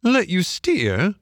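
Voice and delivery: high, drawn-out incredulous question; amused barge woman. A narrator's reading. Barge woman scoffing at Toad's offer, high and amused.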